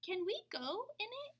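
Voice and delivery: put-on high, hopeful little boy asking; eager kid. Jeremy asking to go into the tree house. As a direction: ask it like an eager young boy. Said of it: "he asked".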